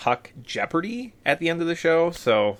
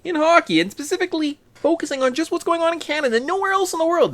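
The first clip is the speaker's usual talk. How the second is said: hyped podcast intro; 13 semitones higher than usual. Acted.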